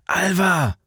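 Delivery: high urgent call of her name. A narrator's reading. Man shaking her awake before the bombers.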